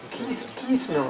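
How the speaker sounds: put-on Bengali accent. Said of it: "the Bengali accent".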